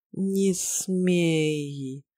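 drugged, slurred and heavy-tongued; slurred drowsy speech. A narrator's reading. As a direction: barely move the tongue, slurring. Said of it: "еле ворочая языком".

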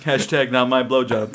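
usual speaking voice

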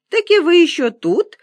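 put-on high, loud old man cutting in. A narrator's reading. Old man breaks into the woman's speech, high and loud. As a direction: butt in with a high-pitched question. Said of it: "вплёлся в её речь голос старичка".

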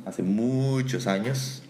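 "muchos" drawn way out; emphatic stretching. Real, not acted. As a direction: stretch "muchos" very long and slow to show how long ago. Stressing how long ago. ("muchos" muy alargado, con énfasis)

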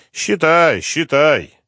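high, loud mocking repeat; general's taunt. General taunting Slepnyov, loud and high. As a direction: needle him with a sneer. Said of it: "проговорил генерал".

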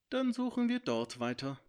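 high, firm decision; decisive dragon. A narrator's reading. Dragon decides where the friends search next, high and firm. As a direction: lead the others on.

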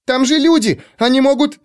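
put-on high, eager young girl; excited girl. A narrator's reading. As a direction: protest like a girl.

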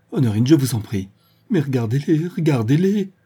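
supplique montante, plus aiguë; suppliant. Acted. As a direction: implorer avec urgence. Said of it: "Véronique la supplia". (supplier Honorine, voix qui monte)

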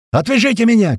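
high, loud cry for help; shouted plea. A narrator's reading. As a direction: shout to be cut loose. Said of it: "кричит Большой". (громкий крик о помощи)

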